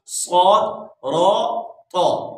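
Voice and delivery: "स्वाद, रा, तो" thick and full-mouthed; heavy letters. Shown as a demonstration. Teacher naming three heavy letters, each emphatic. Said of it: "मोटे words हैं".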